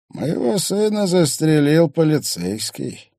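put-on regretful old man. A narrator's reading. Father speaks of his son shot by a policeman, regretful old man. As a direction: lament it high and strong, drawing out key words. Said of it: "только сожаление".

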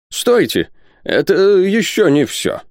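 high-pitched, insistent professor; professor's objection. A narrator's reading. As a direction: halt them in a high character voice. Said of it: "заявил Пальмирен Розет".

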